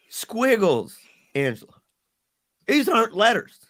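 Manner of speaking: can't believe what he's seeing; shocked disbelief. Retold or imitated. Dad telling Angela her scribbles aren't letters, stunned.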